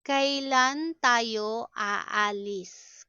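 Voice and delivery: deliberate, word by word; slow teaching model. Shown as a demonstration. Teacher models a question about leaving, slowly. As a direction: stretch each syllable for learners.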